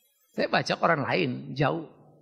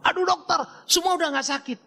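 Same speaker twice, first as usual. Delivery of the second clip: relieved patient; 10 semitones higher than usual. Retold or imitated. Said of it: "Besok dia pulang".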